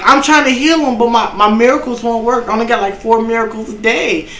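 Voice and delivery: high-pitched pleading, lightly acted; mock distressed healer. Acts out the panicking healer.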